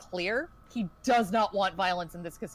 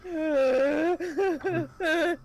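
higher, louder crying plea; drunk sobbing; 6 semitones higher, 3 dB louder than usual. Retold or imitated. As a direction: wail through drunken sobs. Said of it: "He starts just sobbing".

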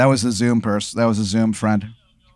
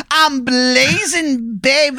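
slow, very high drawn-out promo; hammed-up wrestler; 10 semitones higher, slower than usual. Wrestler hyping himself on the mic, in a slow high drawl. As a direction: drag it out like a wrestling hype man.

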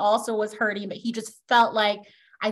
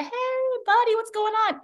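high-pitched, breezy dad greeting; friendly dad; 11 semitones higher than usual. Acted. Dad surprising his buddy at the front door, friendly. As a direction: call out a chummy hello, pitched way up. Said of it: "It's the dad".

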